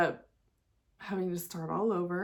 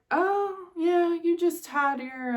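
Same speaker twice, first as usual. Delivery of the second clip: doctor voice; 11 semitones higher, 4 dB louder, slower than usual. Retold or imitated. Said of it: "And he was like".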